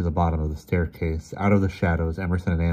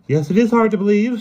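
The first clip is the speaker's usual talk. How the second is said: high, loud interrupting snap; snapped; 9 dB louder than usual. A narrator's reading. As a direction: cut in sharply, voice jumping up. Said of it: "snapped Emerson, cutting in suddenly".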